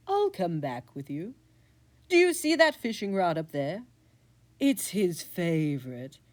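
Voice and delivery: lightly voiced scheming cat, aggrieved; a petty cat airing his grudge; a hint of a vengeful cat; plotting cat.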